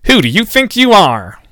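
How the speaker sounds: a reporter demanding his name; indignant Rita. A narrator's reading. Rita confronts the man blocking the street, loud and indignant.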